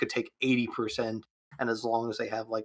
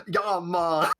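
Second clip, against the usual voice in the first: put-on quick, loud Jamaican accent; mock Jamaican accent; 7 semitones higher, 6 dB louder than usual. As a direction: toss off a hammy Jamaican greeting. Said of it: "a Jamaican accent or something?".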